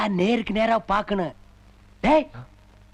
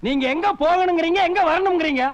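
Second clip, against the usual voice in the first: raised-pitch, exasperated comeback; higher, annoyed questioning; indignant retort; 5 semitones higher, 4 dB louder than usual. Acted. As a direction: fire the complaint back. Retorts to elder's complaint.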